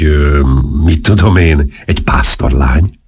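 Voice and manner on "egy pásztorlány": ordinary delivery, nothing marked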